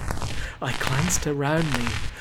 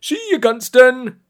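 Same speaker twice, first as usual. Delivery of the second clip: high, loud, jolly farewell; merry bellow; 10 semitones higher, 7 dB louder than usual. A narrator's reading. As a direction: call out cheerfully across a crowd. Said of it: "bellowed merrily".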